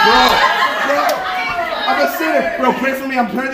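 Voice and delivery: high, loud, frantic storytelling; hammed-up urgent pleading; mock panic. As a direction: act out a desperate scramble, played for laughs. Acts out frantic search to confess.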